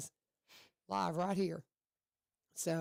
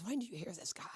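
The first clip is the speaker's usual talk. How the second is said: whispered quote; 6 dB quieter than usual. Retold or imitated. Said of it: "She says".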